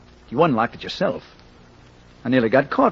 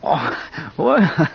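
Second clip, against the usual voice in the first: nervous laugh; 3 semitones higher than usual. Acted. Relieved nervous laugh about red hair.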